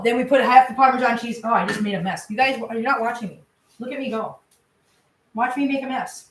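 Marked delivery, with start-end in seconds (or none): none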